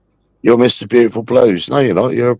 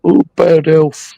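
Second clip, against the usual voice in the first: slow, higher whiny mock plea; mock pleading whine; 6 semitones higher than usual. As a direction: whine it like a faker begging sympathy. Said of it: "plead fucking".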